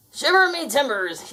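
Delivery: bellowing pirate. Acted. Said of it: "he bellowed".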